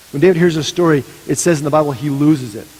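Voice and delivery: ordinary delivery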